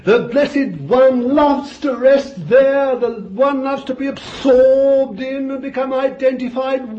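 high, loud, ringing preacher's cry; full-force preaching. Real, not acted. Preacher exulting over the dove on Jesus, shouting. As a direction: lift the whole room with it.

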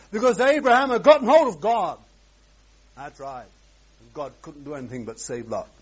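ordinary delivery

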